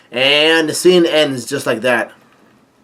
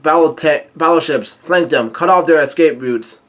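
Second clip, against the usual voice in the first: ordinary talk, nothing marked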